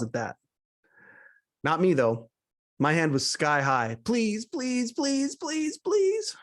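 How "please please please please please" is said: pleading that climbs higher and higher; desperate pleading. Retold or imitated. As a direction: beg in a rising, squeaky-high voice. Eager audience member begging to be picked.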